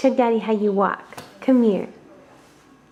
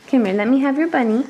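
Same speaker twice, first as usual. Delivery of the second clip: ordinary delivery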